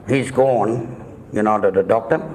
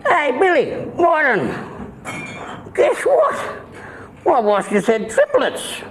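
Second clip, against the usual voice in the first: high, slow, silly put-on voice; high put-on cousin voice; slower than usual. Cousin boasting to the barman in a high silly voice. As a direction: squeak it out like a proud new dad. Cousin Albert retold in joke.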